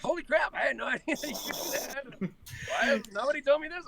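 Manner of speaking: high-pitched retold astonishment, laughing through it; amazed. Client discovering his hidden talent, amazed. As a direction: blurt it out in amazed disbelief.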